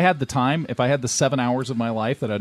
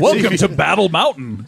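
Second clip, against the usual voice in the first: announcer voice; 5 semitones higher, 5 dB louder than usual. Pretend. Game announcer voice.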